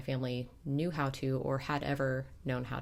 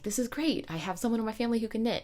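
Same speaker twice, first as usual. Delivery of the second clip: excited; 7 semitones higher, faster than usual. Retold or imitated. Excited retelling.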